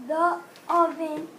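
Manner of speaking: ordinary delivery, nothing marked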